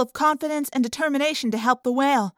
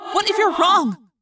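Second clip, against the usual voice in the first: high, loud challenge; blunt; 7 semitones higher, 5 dB louder than usual. A narrator's reading. Friend doubting the rescue plan, blunt challenge. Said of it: "Rachel asked bluntly".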